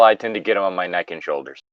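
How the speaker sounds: normal talking voice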